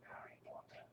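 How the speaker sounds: hushed magic word; whispering. Pretend. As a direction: whisper a spell. Magic spell on child's finger.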